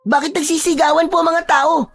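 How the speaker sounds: put-on very high, childlike voice; high-pitched boyish questioning; puzzled young boy. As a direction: ask like a confused kid just woken. Voices Boyet, confused boy.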